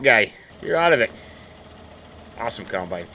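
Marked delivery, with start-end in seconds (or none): 2.4-3.1 quiet admiring mutter; low, soft, under the breath; soft murmur. Real, not acted. Farmer admiring his combine, says it quietly under his breath. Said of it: "repeated louder".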